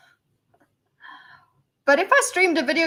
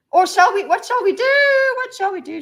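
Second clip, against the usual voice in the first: high singsong; 9 semitones higher, 6 dB louder than usual. Real, not acted. Playful musing to chat.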